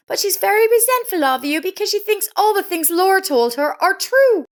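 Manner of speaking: bitter woman's voice. A narrator's reading. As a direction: voice Lisa, high and resentful. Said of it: "She said bitterly".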